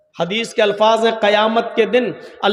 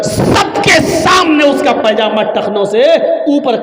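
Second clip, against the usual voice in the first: fiery preaching; 2 semitones higher, 5 dB louder than usual. Acted. Preaching at full force.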